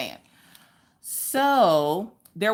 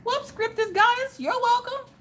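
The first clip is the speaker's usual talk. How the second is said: falsetto greeting. Real, not acted.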